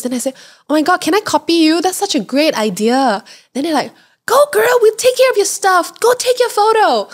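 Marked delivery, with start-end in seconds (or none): 0.7-3.2 high, gushing enthusiasm; excited admiration. Retold or imitated. Traveller asking to copy couple's photo, excited. 4.3-7.1 very high, louder, egging someone on; enthusiastic cheering on. Retold or imitated. Couple urging traveller to take photo, enthusiastic cheering.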